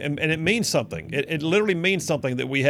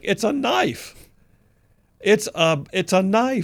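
higher, incredulous scoff at the ban; exasperated mock retort; 6 semitones higher, slower than usual. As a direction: act out the disbelief, high and mocking. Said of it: "I'm like".

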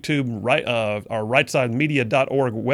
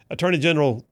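ordinary talk, nothing marked

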